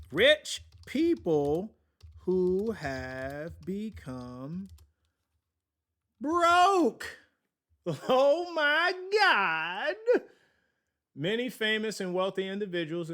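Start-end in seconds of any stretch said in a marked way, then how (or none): 0.1-4.7 very slow, drawn-out announcer delivery; ponderous, theatrical headline reading; dramatic title read. Acted. Reads headline in put-on voice. 6.2-9.9 loud, very high drawn-out wail; long, high, theatrical outcry; mock horror. Mock dramatic outcry at headline.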